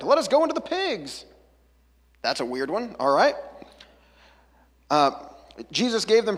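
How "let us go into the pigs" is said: much higher, pleading cry; begging demons. Acted. Demons begging Jesus, high and pleading. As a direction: plead desperately to be sent into the pigs. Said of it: "they begged".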